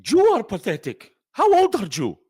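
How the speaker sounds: loud, affronted retort in a changed voice; indignant snap back. Acted. Cop hits back at the director, loud and offended. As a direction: fire the insult straight back, offended.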